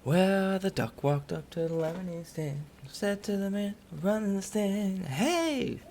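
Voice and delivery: lightly voiced joke-telling duck; mock storyteller. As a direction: tell it like a children's tale. GM tells the duck song joke.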